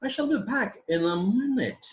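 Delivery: high-pitched model sentence, dropping on "minute"; falling tone demonstration. Shown as a demonstration. Teacher showing a statement's tone, high then falling on "minute". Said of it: "we use falling tone".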